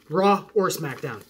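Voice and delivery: ordinary talk, nothing marked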